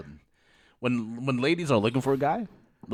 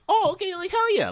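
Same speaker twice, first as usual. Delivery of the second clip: much higher mock eagerness; excited quote. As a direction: jump at the offer of a cute girl. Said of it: "I was like".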